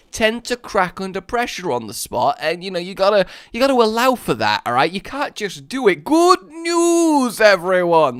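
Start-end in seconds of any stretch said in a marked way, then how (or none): 6.0-8.2 high, loud, stretched "news"; theatrical announcing character voice; put-on character catchphrase. Shown as a demonstration. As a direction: swoop up grandly, then drop on "everyone". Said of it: "That was a little bit better".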